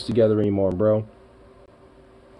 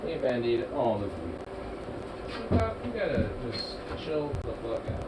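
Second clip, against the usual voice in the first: soft lower pet talk to Bandit; cooing at the cat; 3 semitones lower, 7 dB quieter than usual. Real, not acted. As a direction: coo tenderly to a fluffy cat.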